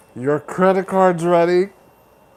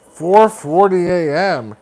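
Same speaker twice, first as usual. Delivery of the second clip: ordinary talk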